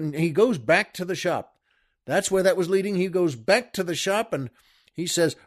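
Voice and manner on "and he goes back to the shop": normal talking voice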